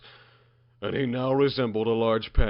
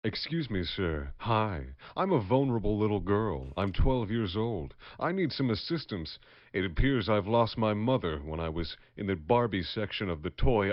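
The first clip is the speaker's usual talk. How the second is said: put-on soft, innocent little girl, deadpan; 4 dB quieter than usual. Lost girl asking a stranger for help, soft and innocent. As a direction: play a naive lost girl, softly.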